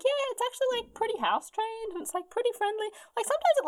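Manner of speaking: high-pitched breezy owner; acted reassurance. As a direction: vouch for your pet in a high voice. Voicing lion owners reassuring Rosa.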